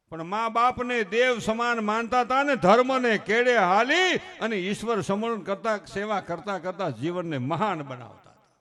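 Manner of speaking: loud, high, ringing oratory; impassioned preaching. Acted. Preaching how children revered parents like gods, loud fervent oratory. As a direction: build to a drawn-out proclamation, then come down. Serious preaching after the jokes.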